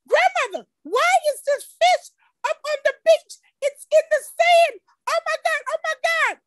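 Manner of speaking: put-on girl yelling to grandmother about a fish on the beach, high and alarmed; alarmed little girl. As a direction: play your ten-year-old self freaking out. Child self alarmed at fish.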